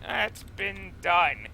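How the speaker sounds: slow, lightly put-on French voice; mock French dismissive sigh; bad French accent. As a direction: scoff it like a snooty Frenchman, half-hearted. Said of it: "That was a terrible French accent".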